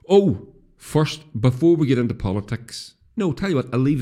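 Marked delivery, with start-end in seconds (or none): none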